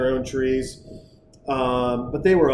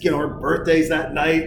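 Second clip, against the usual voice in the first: usual speaking voice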